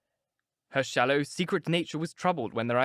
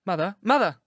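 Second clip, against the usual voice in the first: a loud, high cry to her mother; 6 semitones higher, 6 dB louder than usual. A narrator's reading. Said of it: "she cried".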